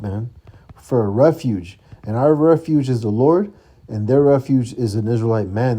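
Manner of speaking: ordinary talk, nothing marked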